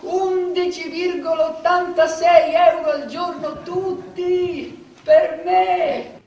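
high, loud, dragged-out gloating; triumphant. Acted. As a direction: stretch it out in triumph. Triumphant over tiny daily sum.

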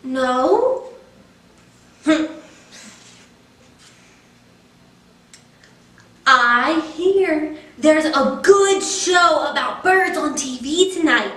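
lightly voiced pleading pigeon, wheedling and bargaining; a cheeky pigeon stalling bedtime. Pigeon begs the child to let him stay up, lightly pleading.

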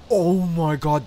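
loud gasp then a slow, stunned oh my god; gasping surprise. Real, not acted. Gamer stunned by the car's speed, gasping surprise. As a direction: let the speed knock the breath out.

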